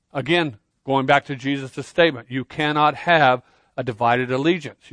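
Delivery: usual speaking voice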